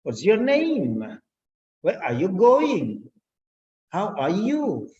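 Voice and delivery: slow, with "name", "going" and "you" swooping up then falling; up-and-down question tune. Shown as a demonstration. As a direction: stretch "name", "going" and "you" in a rise-fall. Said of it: "The way how your tone falls up and down".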